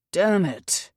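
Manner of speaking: a gruff snarl of frustration; angry snarl at a stuck door. Acted. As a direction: bite it out in anger. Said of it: "Finn snarled".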